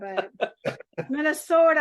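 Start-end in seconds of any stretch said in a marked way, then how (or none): 1.1-1.8 Minnesota, louder and higher in a mock Fargo accent. Trying the Minnesota accent, hammed-up and louder. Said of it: "I don't know how good I'm on that with Fargo".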